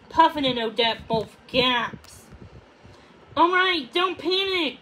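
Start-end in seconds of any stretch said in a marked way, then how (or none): 3.4-4.8 high, loud urging calm; urgent insisting. A narrator's reading. Puffin urging Odette not to panic, urgent. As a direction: press it hard though scared. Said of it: "Puffin insisted".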